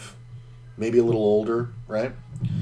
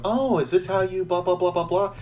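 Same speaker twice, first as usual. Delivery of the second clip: lightly voiced, higher mock-innocent question; 8 semitones higher than usual. Grandma fooling card players, mock innocent. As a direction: play dumb in a sweet higher voice. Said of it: "she would do the thing of".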